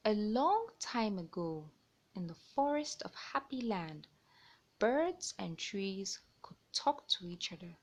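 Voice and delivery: lively picture-book narration; reading to children. A narrator's reading. Storyteller reading a bird tale to young listeners. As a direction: read it out like storytime.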